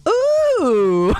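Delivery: put-on high loud toddler "Ooh"; toddler whine. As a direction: whine like an upset little girl. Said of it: "She would go".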